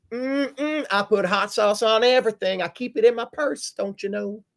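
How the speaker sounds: mock Hillary pandering in a Southern twang; fake Southern accent as Hillary. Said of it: "my fake accent when I'm Hillary Clinton".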